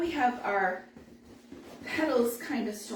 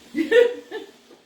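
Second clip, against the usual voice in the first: big high laugh bursting out; loud high laugh; loud burst of laughter; 12 semitones higher, 11 dB louder than usual. Real, not acted. Laughing at coffee joke.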